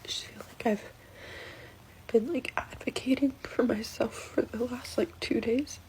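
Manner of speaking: soft tearful whisper. Real, not acted. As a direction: whisper as if holding back tears. Tearful whisper about husband.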